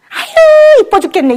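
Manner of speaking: a squeal up high on 아유, then coo; mock-gush over a cute child; gushing doting mom. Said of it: "이거 아니면".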